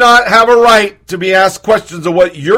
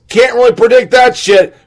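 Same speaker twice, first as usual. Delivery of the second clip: ordinary talk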